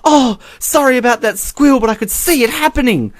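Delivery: high, gushing apology; hammed-up squeaky commentator; imitating flustered commentator. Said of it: "she's like".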